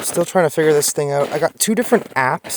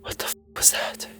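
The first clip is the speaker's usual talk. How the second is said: shaken whisper; 14 dB quieter than usual. Real, not acted. Shaken after the scare.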